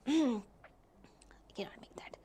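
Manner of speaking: frustrated whisper under the breath. Real, not acted. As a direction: breathe it out to yourself, annoyed. Frustrated aside while the board fails.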